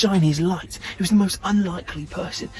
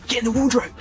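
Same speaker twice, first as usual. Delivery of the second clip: higher and louder, hurried order; aunt hurrying the boy out of sight, urgent; 7 semitones higher, 4 dB louder, faster than usual. Acted. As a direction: push him into hiding fast. Aunt hides boy from dad.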